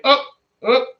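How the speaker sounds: "Oh, oh" in a high, loud, half-caught Australian accent; slip into Australian accent. Shown as a demonstration. Vlogger catching himself drifting into an Australian accent. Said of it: "I almost went into an Australian accent".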